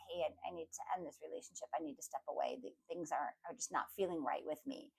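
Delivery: understated, keep it low and matter-of-fact; undramatic quiet realization. Acted. Person admitting to themselves the relationship must end, undramatic. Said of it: "maybe it's not even all that dramatic".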